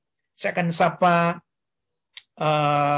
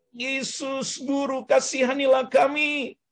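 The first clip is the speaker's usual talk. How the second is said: high, pleading shout for mercy; acted high-pitched cry to Jesus; 8 semitones higher, slower than usual. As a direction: call out from afar as the lepers did. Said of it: "mereka berteriak".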